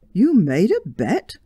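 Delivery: surprised. Acted. George astonished by wife's bet.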